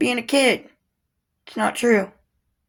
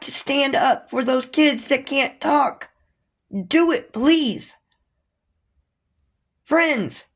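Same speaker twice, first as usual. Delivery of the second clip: higher, urgent pleading rant with pushed words; impassioned rant; 5 semitones higher than usual. Real, not acted. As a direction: plead with people passionately, pitch up. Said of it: "I'm done ranting".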